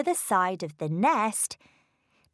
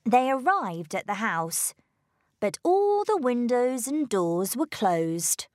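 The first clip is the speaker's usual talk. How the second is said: plain reading voice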